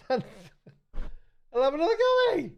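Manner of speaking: high, slow, with a laugh. Shown as a demonstration. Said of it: "That last line was".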